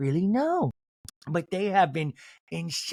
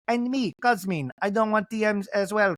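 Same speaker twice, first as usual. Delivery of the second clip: higher joking waiter voice; Cosmin; 4 semitones higher than usual. Pretend. Waiter butting into the women's quarrel, playful put-on voice. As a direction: chime in as a put-on character. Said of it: "Cosmin, the waiter".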